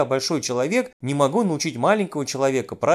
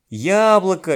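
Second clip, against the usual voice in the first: "Яблоко" louder, first syllable drawn out and stressed; emphatic correction; 3 semitones higher, 5 dB louder, slower than usual. A narrator's reading. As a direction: hit the Я of "Яблоко" to correct a pupil. Teacher corrects the misread word.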